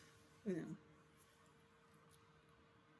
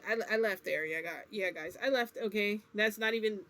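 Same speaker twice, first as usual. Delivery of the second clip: usual speaking voice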